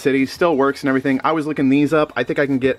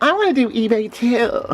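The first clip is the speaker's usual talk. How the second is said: higher, mock eager buddy voice; pitched up and put-on, imitating an excited pal; buddy John's eager ask; 12 semitones higher than usual. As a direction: ape a friend begging to tag along. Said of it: "he was like".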